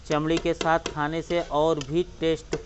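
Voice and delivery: ordinary delivery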